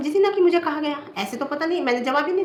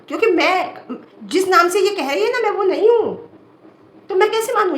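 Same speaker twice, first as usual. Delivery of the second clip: high, louder words through real laughter; laughing; 7 semitones higher, 4 dB louder than usual. Real, not acted. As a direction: laugh it off while denying. Laughing while denying troll's name. (हँसते हुए ऊँची आवाज़ में इनकार)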